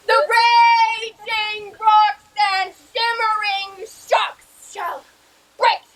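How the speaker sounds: slow, booming ranting tyrant; lofty tyrant. Acted. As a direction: bellow it like a stage tyrant. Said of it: "This was lofty".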